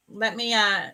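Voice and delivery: ordinary talk, nothing marked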